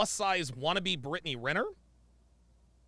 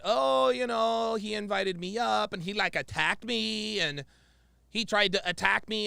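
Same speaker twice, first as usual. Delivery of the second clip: mock lying girl; 5 semitones higher than usual. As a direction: mimic her dubious story, stretching the words. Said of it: "this girl tried to lie on Myron and said that".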